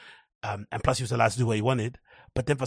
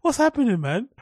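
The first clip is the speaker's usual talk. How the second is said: put-on much higher, louder confused kid; a shocked little boy not understanding; bewildered; 12 semitones higher, 5 dB louder than usual. Son confused by his dad's shouting, high and bewildered.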